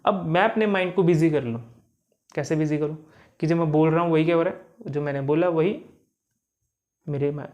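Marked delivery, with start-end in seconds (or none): none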